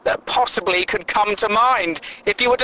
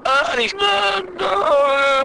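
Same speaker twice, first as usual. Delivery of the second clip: high strained gasping uhs; clutching-chest fake gasps, pitched high; mock soap heart attack; 11 semitones higher, slower than usual. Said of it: "heart attacks they have on soap operas".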